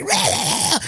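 high mock yelling noise; imitated yelling. Imitating old lady at her dogs, mock yelling. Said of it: "She ain't yelling at 'em like".

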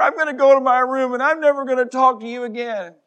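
put-on high, loud sulky kid whine; mock pouting kid storming off; child's tantrum. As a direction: play the stomping-off child. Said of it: "and they're like".

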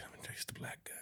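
whispered aside. Real, not acted. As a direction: whisper it like a secret. Joking aside about texting.

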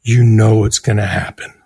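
a hint of a calm friend on the phone; calm reassuring publicist. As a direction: talk him down gently, slowly.

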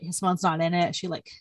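ordinary talk, nothing marked

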